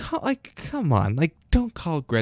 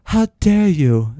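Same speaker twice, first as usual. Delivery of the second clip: slow, louder mock of Greta; 3 semitones higher, 6 dB louder, slower than usual. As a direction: drag out how dare you in mockery. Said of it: "It's a stupid voice".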